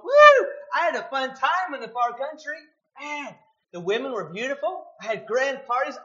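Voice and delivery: loud, high whoop then brash bragging; loud boastful whoop of a prodigal son; partying brother boast. Retold or imitated. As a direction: crow about the wild time like a reveller. Voicing the younger brother's boast.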